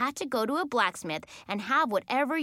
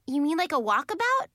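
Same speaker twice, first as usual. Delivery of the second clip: high, raised questioning; surprised; 7 semitones higher, 4 dB louder than usual. A narrator's reading. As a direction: ask it as a startled guess, pitched up. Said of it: "I asked in surprise".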